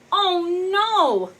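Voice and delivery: high, loud storybook oh no; mock alarm. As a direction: gasp it out for the kids. Reading picture book to kids.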